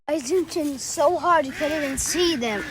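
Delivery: ordinary delivery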